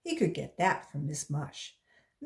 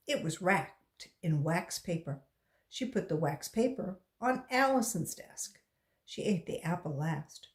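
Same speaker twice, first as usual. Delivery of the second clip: usual speaking voice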